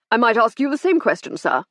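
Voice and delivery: a haughty captain putting him in his place; lofty and pompous, looking down on him. A narrator's reading. Captain snubbing the inspector, pompous and haughty. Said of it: "said Summers pompously".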